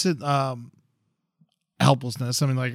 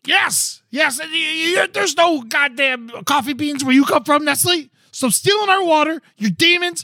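angry rant; 11 semitones higher than usual. Real, not acted. Ranting at Nestle about water and coffee, high angry yell. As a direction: tear into them with a raised, angry voice.

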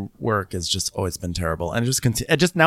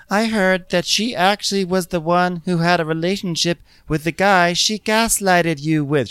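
high put-on gossip voice; mock earnest tattling, pitched up; Whitney; 9 semitones higher than usual. Podcaster mimics Whitney telling Meredith gossip, high-voiced.

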